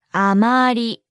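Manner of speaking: あまり drawn out syllable by syllable; slow model. Shown as a demonstration. Teacher breaks down the phrase for not very, slowly. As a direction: say あまり slowly, stretching each vowel.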